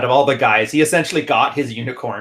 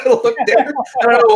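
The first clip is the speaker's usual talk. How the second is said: laughing; 10 semitones higher, 6 dB louder than usual. Real, not acted. Laughs while teasing co-host.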